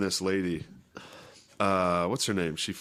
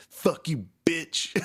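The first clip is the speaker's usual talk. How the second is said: higher mock snap; 8 semitones higher, slower than usual. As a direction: snap it back pitched up. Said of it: "Her response is fucking like".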